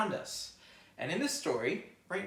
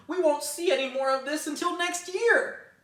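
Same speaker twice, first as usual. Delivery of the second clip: higher, louder sulky bunny; grumpy rabbit; 14 semitones higher, 10 dB louder than usual. Acted. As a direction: mourn the last of the snow. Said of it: "Rabbit grumbled".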